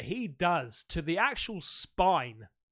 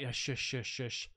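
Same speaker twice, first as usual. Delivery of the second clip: imitating the shushing; 8 semitones lower, 7 dB quieter than usual. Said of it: "it sounds like every time this guy".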